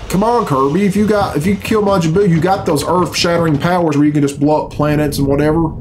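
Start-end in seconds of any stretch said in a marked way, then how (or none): none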